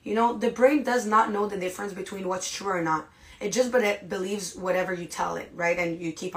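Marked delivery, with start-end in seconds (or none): none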